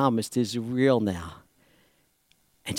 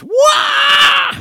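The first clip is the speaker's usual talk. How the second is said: high shouted yell of delight; joyful yell; 6 dB louder than usual. Acted. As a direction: leap with a cry of joy. [name] leaping into a hug.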